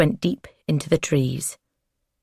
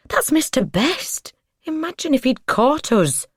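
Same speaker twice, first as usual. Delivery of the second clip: high-pitched, flustered; flustered exclamation; 10 semitones higher than usual. A narrator's reading. Woman tells partner they were nearly caught, flustered. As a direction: pitch it right up, scandalised. Flushed woman recognises the man.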